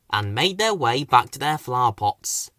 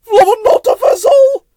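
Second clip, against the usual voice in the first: very high, loud frightened cry; high loud panicky shout; scared shout; 12 dB louder than usual. Acted. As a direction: cry out with knees shaking. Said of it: "his knees shaking".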